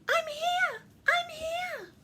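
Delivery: very high, loud squeal; excited Ruby. A narrator's reading. Ruby answers Santa's call, excited high squeal. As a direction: pipe up eagerly, sky-high. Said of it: "She sounds excited".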